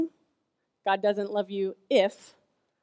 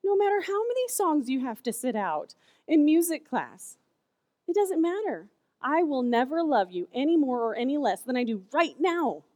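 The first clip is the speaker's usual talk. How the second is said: reassuring mom; 6 semitones higher than usual. Retold or imitated. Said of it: "I said".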